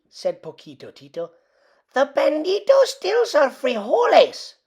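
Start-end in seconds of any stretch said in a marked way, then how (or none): 1.9-4.5 high, excited small dog voice; higher little dog voice. A narrator's reading. Poquito warns Skipito about the bandit, high little dog voice. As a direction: squeak it out urgently.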